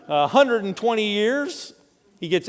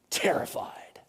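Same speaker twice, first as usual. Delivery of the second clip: breathy whisper of terrified; 9 dB quieter than usual. Real, not acted. Preacher stressing disciples' fear, whispered terrified. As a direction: drop to a whisper on the word terrified. Dramatic sermon emphasis.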